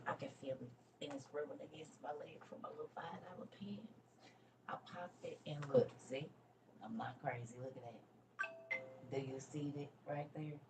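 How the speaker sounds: conspiratorial near-whisper about the tag; quiet aside. Real, not acted. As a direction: lean in and hush, half to yourself. Aside about a tag on her pants.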